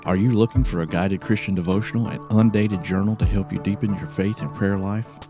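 ordinary delivery

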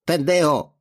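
put-on high-pitched young man's call; cutting shout. A narrator's reading. Young banger interrupts the beating, a high cutting shout. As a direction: shout the insult across the lot. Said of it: "voice of an eighteen-year-old cut through".

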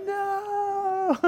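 high drawn-out mock wail; toddler's wail. Four-year-old son to dad about the sliver, mock wail. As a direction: imitate a small boy crying no.

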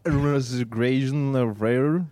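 Swedes mocking a generic American accent; fake American accent. Shown as a demonstration. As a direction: toss off vague American-sounding mumble. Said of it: "how does a fake American accent sound?".

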